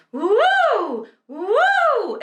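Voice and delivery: high swooping "woo" sirens; vocal siren. Shown as a demonstration. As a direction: slide up loud into head voice like a siren. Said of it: "we do a siren".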